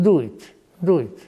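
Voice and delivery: ordinary talk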